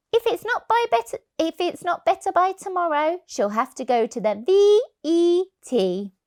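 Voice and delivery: a mum fretting over the cat; mother's voice. Acted.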